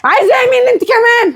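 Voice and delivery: high, exasperated shout; yelling. Acted. Daughter turning on her mother, yelling. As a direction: snap back in a high pitch. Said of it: "مالك بتزعقي ليه؟".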